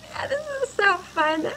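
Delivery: high-pitched delight; thrilled at how fun it is. Real, not acted. Driver delighted with the new safari Jeep, thrilled.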